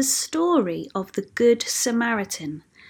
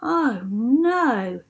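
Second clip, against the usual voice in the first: higher, drawn-out sigh of disappointment; sighing dismay; 4 semitones higher than usual. A narrator's reading. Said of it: "the crowd sighed".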